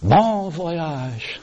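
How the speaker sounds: high, buoyant send-off; imitated old man's merry well-wishing. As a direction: sing out a cheery farewell. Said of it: "wishing her this buoyant".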